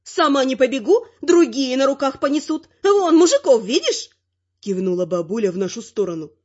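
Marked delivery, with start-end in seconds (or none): 0.0-4.1 high-pitched granny boasting; bickering granny character, pitched up; cocky granny. A narrator's reading. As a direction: brag in a raised old-lady voice. Narrator voices the grandmother.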